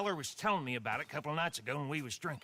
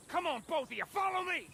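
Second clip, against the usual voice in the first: urgent shout; 10 semitones higher than usual. Acted. Gang leader rallying the bickering pair to the stagecoach, shouting. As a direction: yell at them to follow, rising.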